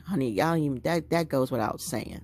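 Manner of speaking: normal talking voice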